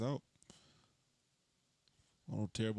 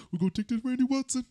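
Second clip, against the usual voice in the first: playful character voice; 9 semitones higher, 8 dB louder than usual. Pretend. Playful impression, loud and high.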